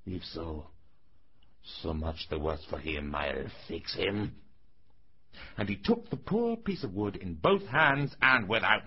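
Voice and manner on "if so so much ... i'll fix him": muttered threat. A narrator's reading. Old carpenter threatens whoever hides in the wood, low menace. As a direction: threaten under your breath, pitched low.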